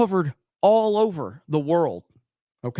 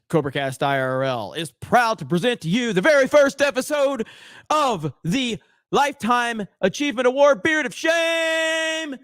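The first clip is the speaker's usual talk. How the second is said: booming showman intro, rising to a shout; mock-grand award announcer, getting higher and louder; ringmaster announcement; 7 semitones higher, 3 dB louder than usual. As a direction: present it like a circus ringmaster.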